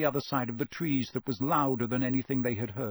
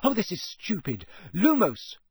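high, loud worried calls; anxious calling; 8 semitones higher, 4 dB louder than usual. Acted. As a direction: search the dark for him. Hermione searching in the dark.

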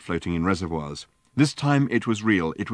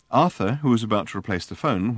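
plain reading voice

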